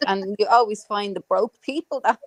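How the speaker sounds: normal talking voice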